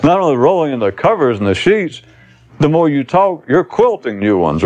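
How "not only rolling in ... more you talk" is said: usual speaking voice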